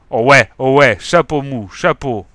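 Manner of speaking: loud dopey parroting; like an idiot. Acted. Moustachu mocking the inspector, loud and dopey. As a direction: echo it like a fool. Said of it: "comme un idiot".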